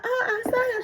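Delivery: very high, swooning squeal; giddy coworker. Retold or imitated. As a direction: gush it way up high. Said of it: "It was like".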